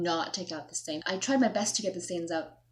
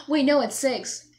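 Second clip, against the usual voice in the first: sudden realization; 5 semitones higher, 8 dB louder than usual. Retold or imitated. Realizing mistake, correcting self.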